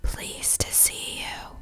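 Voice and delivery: slow, breathless sick whisper; gravelly whisper. A narrator's reading. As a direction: rasp it like a dying man. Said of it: "low and gravelly like a whisper".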